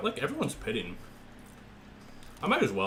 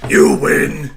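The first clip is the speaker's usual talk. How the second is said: "You win" shouted much louder; loud mock surrender; 3 semitones higher, 17 dB louder than usual. Real, not acted. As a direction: yell "You win" like a dramatic defeat.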